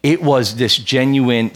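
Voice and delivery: ordinary delivery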